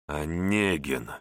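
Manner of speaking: low, slow hiss through gritted teeth. Acted. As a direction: spit the name Онегин out through clenched teeth. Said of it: "процедил я сквозь зубы".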